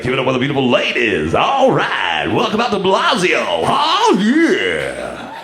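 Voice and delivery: hammed-up club announcer with big pitch swoops; strip club DJ. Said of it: "guys know that voice right away".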